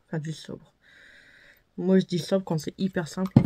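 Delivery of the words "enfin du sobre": murmuré. Real, not acted. Commente la teinte sobre.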